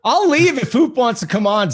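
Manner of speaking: high-pitched indignant offer; mock-offended offer. Real, not acted.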